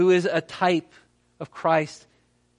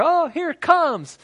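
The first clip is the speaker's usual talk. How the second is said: much higher, slow hopeful cry; hopeful excitement; 10 semitones higher, 4 dB louder than usual. Retold or imitated. Stranded castaway spotting the rescue copter, excited. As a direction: sing it out with rising hope.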